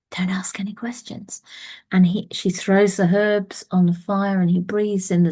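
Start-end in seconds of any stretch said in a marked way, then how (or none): 0.1-1.4 whispered warning. Acted. Warning before sending him back.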